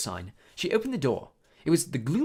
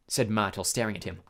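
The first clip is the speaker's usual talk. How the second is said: ordinary delivery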